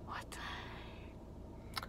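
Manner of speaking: slow disbelieving whisper. Real, not acted.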